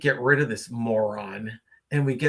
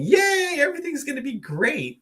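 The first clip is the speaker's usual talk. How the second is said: high, sarcastic "yay" cheer; mock cheery; 10 semitones higher than usual. Mocking forced positivity in dark times, sarcastic cheer. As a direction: fake upbeat cheerleader tone. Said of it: "all cheery and upbeat saying".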